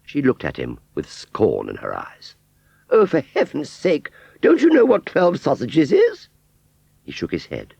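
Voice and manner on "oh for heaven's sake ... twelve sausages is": high, loud scornful scold. A narrator's reading. Aunt Sally scorning the scarecrow who can't count, high scornful scold. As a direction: scorn his ignorance.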